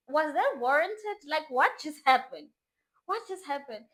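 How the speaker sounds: high, slow, loud questioning; stunned and indignant, acted out; shocked disbelief. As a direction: stretch out each incredulous word. Acted shock at his outburst.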